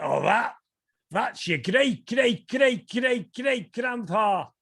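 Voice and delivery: lightly acted grandpa, rising to a drawn-out finish; grandpa's retold answer. Acting grandpa's answer in retold story.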